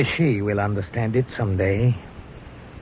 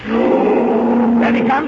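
tense shout; 12 semitones higher, 5 dB louder, much slower than usual. Acted. Hunters tracking charging lion.

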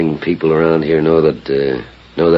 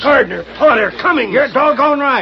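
panicked. Acted. Villain sees he is caught.

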